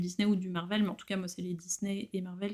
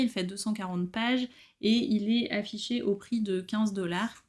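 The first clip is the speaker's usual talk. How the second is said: ordinary talk, nothing marked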